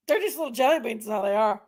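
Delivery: normal talking voice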